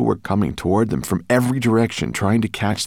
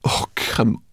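higher, louder, slow exasperation; complaining; 11 semitones higher, 6 dB louder than usual. A narrator's reading. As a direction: whine in exasperation. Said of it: "complained Sorrel".

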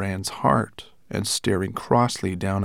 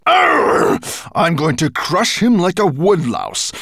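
raging dragon; 12 semitones higher, 9 dB louder than usual. Acted. Raging dragon giving orders.

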